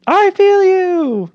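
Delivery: high, loud, very slow fake empathy; milk it like a hired actor playing a politician; hammed-up actor empathy. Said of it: "go out there and be like".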